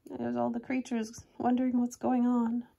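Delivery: ordinary delivery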